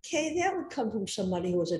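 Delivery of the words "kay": normal talking voice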